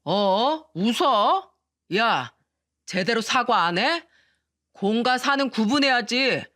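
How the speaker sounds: a hint of a tipsy boss lecturing, slow; lightly voiced drunk superior scolding; lightly voiced drunk in-law demanding an apology; drunk bully.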